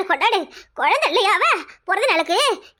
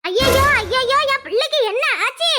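alarmed cry; 5 semitones higher than usual. Acted. Mother hears daughter collapsed.

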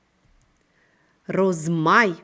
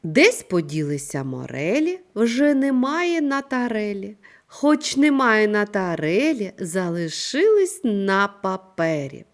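loud, lively rhyme reading; teacher reciting the morello rhyme for the class, louder and animated; verse read aloud; 3 semitones higher, 6 dB louder than usual. Acted. Teacher reads the second verse to her class, louder and lively.

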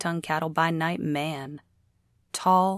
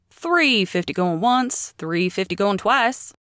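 auctioneer countdown; 4 semitones higher, 5 dB louder than usual. Acted.

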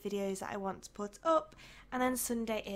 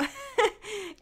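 guilty little laugh; 11 semitones higher, 5 dB louder than usual. Real, not acted. Laughs at not going out.

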